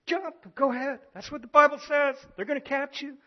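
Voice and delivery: high, loud sneering tempter voice; Satan's taunt. Retold or imitated. Satan daring Jesus to jump, high sneering taunt. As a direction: egg him on to jump, slyly daring. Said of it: "He's saying".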